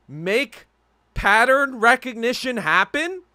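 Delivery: exasperated rhetorical scolding, hammed up; exasperated old rant. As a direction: scold everyone like a fed-up old man. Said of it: "at the age of fucking eighty and be like".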